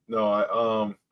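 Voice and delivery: ordinary delivery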